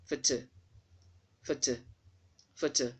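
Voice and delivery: "photo" said "FOH-tuh", repeated with pauses; schwa pronunciation. Shown as a demonstration. Teacher drilling the unstressed syllable for learners, schwa pronunciation. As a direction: reduce the last O of "photo" to "uh". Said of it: "So please don't pronounce that O".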